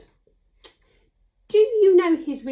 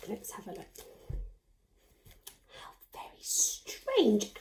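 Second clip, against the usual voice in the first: mock whisper; 3 semitones lower, 9 dB quieter than usual. Teacher whispering in mock wonder over the puppet's bag. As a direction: hush it like a secret discovery.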